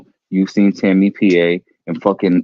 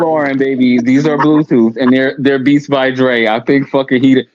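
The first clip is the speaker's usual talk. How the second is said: ordinary talk, nothing marked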